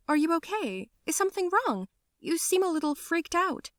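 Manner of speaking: high, gentle worried asking; concerned friend. Acted. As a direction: sound caringly worried.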